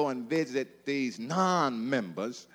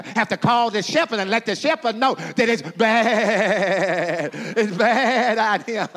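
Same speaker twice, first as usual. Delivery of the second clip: chanted, rising refrain building to a loud, high call; preaching climax; 3 semitones higher, 4 dB louder than usual. Acted. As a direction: build the chant on "It's bad" until you cry out for the shepherd.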